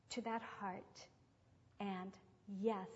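ordinary delivery

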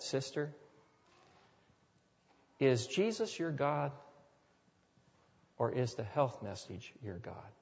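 soft, gentle and tender; gentle, loving. Retold or imitated. Pastor gently questions the sick sister at her bedside, soft and tender. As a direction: ask it softly, as if holding her hand. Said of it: "I carefully, gently, I hope lovingly".

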